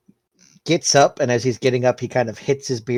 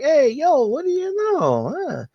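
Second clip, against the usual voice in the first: gloating; slower than usual. Acted. Vinny gloating after the game.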